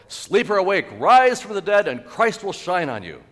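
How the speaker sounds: loud, high proclamation, easing at the end; ringing pulpit cry; commanding shout. Acted. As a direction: call the sleeper to wake. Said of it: "hear the command of Christ".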